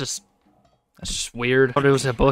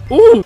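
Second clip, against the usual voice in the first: high, loud "ooh"; sudden high-pitched yelp; startled whoop; 7 dB louder than usual. Real, not acted. Gamer surprised mid-game, whooping.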